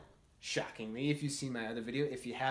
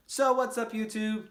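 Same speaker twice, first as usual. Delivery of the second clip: excited greeting; 6 semitones higher, 6 dB louder than usual. Real, not acted. Channel greeting to viewers.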